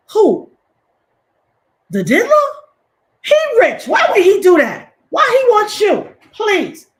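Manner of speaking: very high, loud, scoffing mock fan; outraged fan. Voicing a celebrity fan brushing off accusations, shrill disbelief. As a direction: shriek in disbelief like a defensive fan. Mocking a starstruck defender again.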